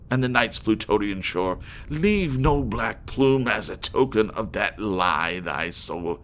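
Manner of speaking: loud, high order to leave; furious command. Acted. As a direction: drive the fiend away in rage. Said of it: "I shrieked".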